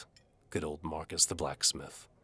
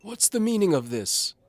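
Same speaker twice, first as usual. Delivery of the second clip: high loud indignant demand; outraged Duke; 11 dB louder than usual. A narrator's reading. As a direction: blast it out like an affronted lord.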